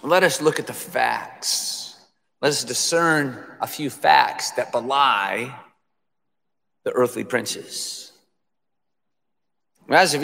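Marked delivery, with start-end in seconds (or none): none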